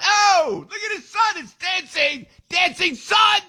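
very high, hammed-up calling out; mock public outcry. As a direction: parody her high accented cries. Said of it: "I'm not gonna go around the parking lot".

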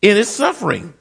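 high, drawn-out preacher's cry; preaching. Real, not acted. Preacher tells of Job weeping in his suffering, preaching. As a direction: preach it high, stretching the key words.

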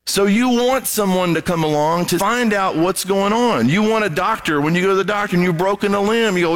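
ordinary delivery

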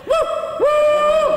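bunyip reply coming closer. Retold or imitated. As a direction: call as the beast right nearby. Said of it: "I heard it's coming closer".

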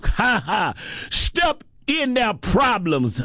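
ordinary talk

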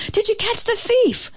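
very high, eager asking; eager group question. A narrator's reading. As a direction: ask like a crowd of excited animals. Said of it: "they all asked at once".

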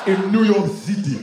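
put-on high, loud Nigerian-accented plea; hammed-up Nigerian accent, pitched high; thick Nigerian accent. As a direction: imitate an eager Nigerian cousin. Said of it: "Not with that accent".

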